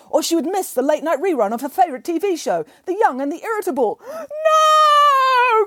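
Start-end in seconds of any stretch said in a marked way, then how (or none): 4.1-5.7 high loud anguished shout; anguished cry. Acted. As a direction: cry out in despair. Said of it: "cried Boris".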